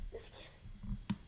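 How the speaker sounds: slow whispered joke; whispered aside. Real, not acted. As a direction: breathe it out as a quick joke. Joking aside after a laugh.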